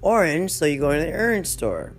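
ordinary delivery

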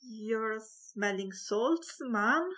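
ordinary delivery, nothing marked

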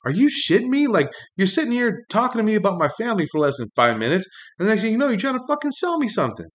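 retelling his inner outrage at the sales pitch, rising high; mock outrage. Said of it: "You're like".

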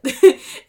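sudden loud, high burst of laughter; real laugh breaking into the sentence; quick high laugh; short laugh. Real, not acted. Laughs mid-sentence about crying easily.

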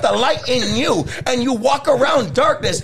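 fiery shouted preaching. Real, not acted. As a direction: preach it at full force, climbing high.

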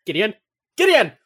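frantic shout of Gideon; calling out. Retold or imitated. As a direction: yell Gideon to stop him. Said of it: "everyone's going".

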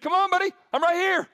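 loud, high cheering call; encouraging call. Retold or imitated. Jesus calls Peter out onto the water, loud and high. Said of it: "Jesus said".

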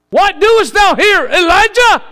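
loud, very high thundering call; booming voice of God. Pretend. God confronts Elijah hiding in the cave, booming and high. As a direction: call down from heaven, demanding. God's question in King James words.

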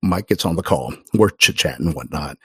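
ordinary talk, nothing marked

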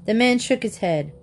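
plain reading voice

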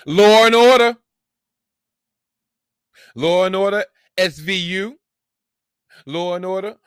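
high, loud, held-out fanfare; excited, high-pitched hype chant; hyped-up show announcing. Acted. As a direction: belt out the titles like a fan. Hyped chanting of favourite show's names.

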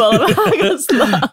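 slow, laughing through the word. Real, not acted. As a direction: let it break into laughter. Laughs mid-sentence.

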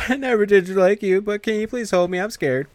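high, pleading frightened voice; frightened girl asking to be held; scared girl. Retold or imitated. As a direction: beg to be held out of fear. She starts freaking out.

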